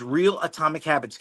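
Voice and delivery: ordinary talk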